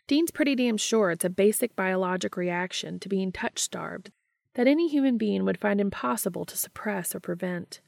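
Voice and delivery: ordinary delivery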